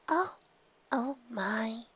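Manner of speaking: a shy pony aghast; soft high gasping "oh my"; timid squeak. Acted. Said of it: "the timid Pegasus squeaked".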